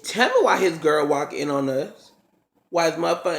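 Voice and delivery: ordinary delivery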